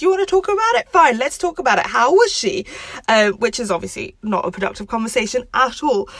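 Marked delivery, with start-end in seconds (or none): 0.0-2.7 high, raised, biting mock challenge; Rachel's hurtful challenge. Rachel turning on Ross when talk gets hurtful, angrily challenging. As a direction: imitate a hurt girlfriend firing questions back. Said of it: "it starts getting hurtful because Rachel goes".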